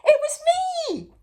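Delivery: high, loud piping voice; Mister Silly confessing to the king. A narrator's reading. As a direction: pipe up eagerly. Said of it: "piped up Mister Silly".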